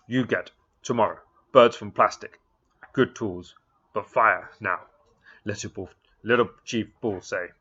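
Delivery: loud, blunt demands in broken English; Little Bull demanding. Acted. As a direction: bark out each demand like a proud chief.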